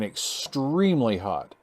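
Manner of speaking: normal talking voice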